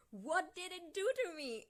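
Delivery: high, loud anguished demand; acted shouting. A narrator's reading. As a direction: shout the character's question. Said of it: "There is no point shouting".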